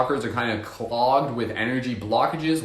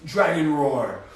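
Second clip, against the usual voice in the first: dragon roar call; 5 semitones higher, much slower than usual. Shown as a demonstration. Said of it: "Do a quick dragon roar".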